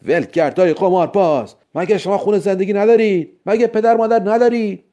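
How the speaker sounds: high-pitched angry policeman yelling; scolding shout. A narrator's reading. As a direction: shout after fleeing gamblers, scolding them. (با داد و سرزنش)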